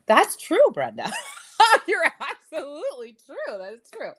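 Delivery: high, loud burst of laughing agreement. Real, not acted. Host agreeing with Brenda's comment on the livestream, laughing. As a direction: crack up while agreeing with the commenter.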